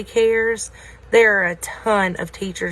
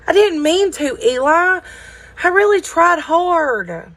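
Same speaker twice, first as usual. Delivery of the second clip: put-on high, louder childlike plea; high, pleading little wooden boy voice; pleading little Wemmick; 9 semitones higher, 3 dB louder than usual. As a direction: beg him like a small worried child.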